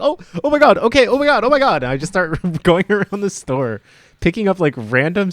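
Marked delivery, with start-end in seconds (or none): 0.0-1.8 high, panicked oh my god; flustered panic. Retold or imitated. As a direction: flap in sudden panic, voice up high. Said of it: "And I was like".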